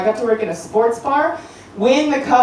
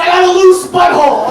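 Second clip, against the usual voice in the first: the response; 8 semitones higher, 8 dB louder than usual. Retold or imitated. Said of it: "That's the response".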